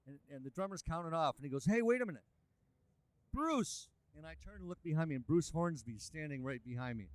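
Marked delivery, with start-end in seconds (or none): 1.7-3.9 high, loud call across the room. Retold or imitated. Drummer stops the count to call Bruce, loud and high. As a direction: drummer hailing Bruce, voice jumping up.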